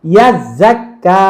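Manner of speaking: ordinary talk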